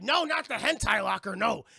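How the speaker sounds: hammed-up panicked protest; mock horror. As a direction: cry out in exaggerated dread. Crew acted in mock horror.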